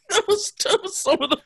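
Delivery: high voice breaking into laughter; laughing. Real, not acted. Cohost cracking up over punchlines, laughing through words.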